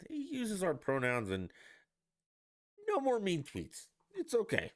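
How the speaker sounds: high, sing-song sarcastic voice; sarcastic mockery. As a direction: parrot the supporters' excuse with a sneer. Mocking Biden supporters' excuses.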